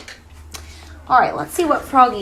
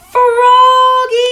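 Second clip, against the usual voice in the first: high, loud shout across the house; loud call; 10 semitones higher, 6 dB louder than usual. Acted. Mother shouts Froggy's name loudly from the house. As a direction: belt out his name to call him. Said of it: "called his mother".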